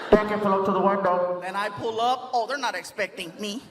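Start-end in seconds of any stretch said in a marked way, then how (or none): 0.1-1.4 muffled intercom employee; drive-thru speaker. Acted. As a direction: talk like a crackly order speaker. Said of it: "They'll come on the speaker".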